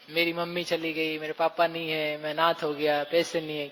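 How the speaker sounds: understated sorrow of someone bereaved; mild mock grieving. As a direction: voice a grieving orphan lightly. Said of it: "कोई रो रहा हो".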